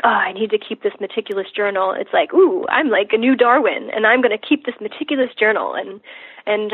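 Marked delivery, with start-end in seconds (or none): 0.0-1.9 burdened chore. Acted. Patient voicing the overkill of meticulous journaling, burdened. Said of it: "So instead of being like". 2.3-5.7 playful mock excitement with a swooping Ooh. Patient reframing journaling as playing new Darwin, playful. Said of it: "I try to view it as fun".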